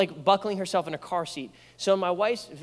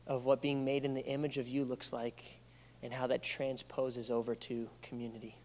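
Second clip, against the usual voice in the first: soft, slow, hushed prayer; 3 semitones lower, 4 dB quieter, slower than usual. Acted. Pastor praying over the congregation, soft and hushed. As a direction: pray quietly over the room.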